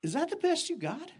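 high, cocky challenge; defiant retort. Retold or imitated. Young [name] answering the bully, defiant. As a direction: throw it back as a dare. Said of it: "what I wanted to say".

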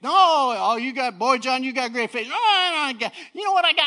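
high, loud acted protest; fired-up preaching. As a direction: shout it out like an impassioned preacher. Acts out exchange while preaching.

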